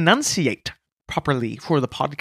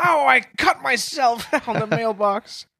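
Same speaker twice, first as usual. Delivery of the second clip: mock pained yelp; 7 semitones higher than usual. Mock injury on the mailbox.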